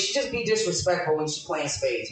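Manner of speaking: normal talking voice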